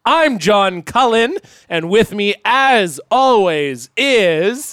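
loud, high podcast intro announcer; hype announcer. Acted. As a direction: boom it out like a show host. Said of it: "do the actual real good intro".

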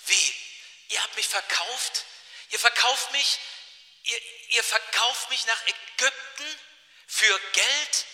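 stunned outrage, stretched out slowly; acted disbelief. Josef to brothers who sold him, in disbelief.

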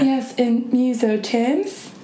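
slow, drawn-out New Zealand accent. Shown as a demonstration. Host quoting the show's review, New Zealand accent. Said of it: "that's my New Zealand accent".

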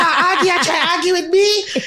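high, laughing through her own answer; laughing retort. Retold or imitated. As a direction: giggle while quoting herself. Mother retelling her reply laughing.

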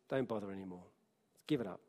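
soft, dismissive murmur; quiet voice of delay. Acted. Preacher voicing delay to the congregation, hushed and dismissive. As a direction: drop the voice low, like a discouraging whisper in the ear. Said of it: "delay will say to you".

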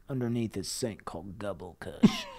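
breathy secret whisper; hushed conspiratorial whisper; whispered aside. Acted. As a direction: let them in on a secret. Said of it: "conspiratorial aside".

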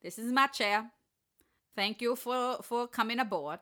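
booming Polar Express conductor voice; train conductor calling. Acted. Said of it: "I don't know why I sound like the conductor in, uh, Polar Express".

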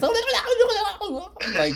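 high-pitched babbling gibberish; squealed cartoon jabber, laughing; Wild Thornberrys kid. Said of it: "he's like that kid from Wild Thornbits".

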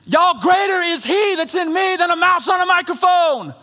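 high, loud shouted preaching; full-force preaching. Real, not acted. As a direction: lift the church with a shout.

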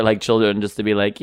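normal talking voice

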